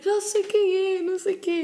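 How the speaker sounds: high, drawn-out imitation of mum; imitated mother. Mum recognises the friend, imitated high and slow. Mum's retold reply.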